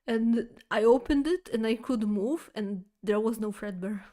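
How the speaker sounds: ordinary delivery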